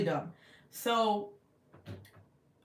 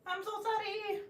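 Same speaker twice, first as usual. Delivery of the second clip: tiny high-pitched sorry; mock-pleading apology; 13 semitones higher, 5 dB quieter than usual. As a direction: squeak out a playful apology. Mock-pleading apology after laughing.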